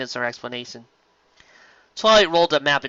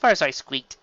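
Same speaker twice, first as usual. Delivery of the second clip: plain reading voice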